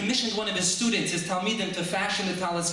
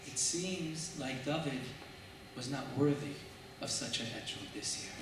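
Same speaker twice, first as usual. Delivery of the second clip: calm acceptance; 5 semitones lower, 10 dB quieter than usual. A narrator's reading. David accepts the loss calmly, softly, facing heaven. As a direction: let it go calmly, almost to yourself. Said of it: "calmly turns his eyes heavenward".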